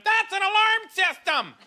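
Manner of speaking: slow, high yelled line; retold yell. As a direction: shout it out slowly like a confused heckler. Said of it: "I had a lady yell out".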